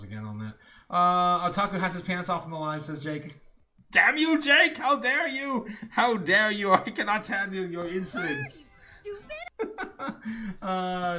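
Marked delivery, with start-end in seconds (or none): 3.9-6.7 hammed-up outraged yell at Jake; loud mock fury, high and shouting; shaking with anger. 6.7-8.7 laughing. Pretend. Mock outrage continues, laughing.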